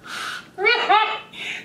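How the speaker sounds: squealing laugh; high delighted laugh. Real, not acted. Delighted by trailer.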